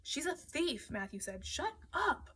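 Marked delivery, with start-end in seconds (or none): 0.0-0.9 higher-pitched accusation; accusing. A narrator's reading. Matthew accuses Rossi. 1.4-2.3 high, heated cut-off; angry. A narrator's reading. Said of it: "I could feel the anger".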